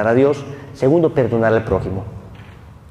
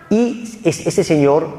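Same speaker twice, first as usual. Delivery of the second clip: ordinary talk, nothing marked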